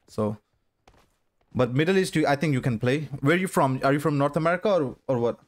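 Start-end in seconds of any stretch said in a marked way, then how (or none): none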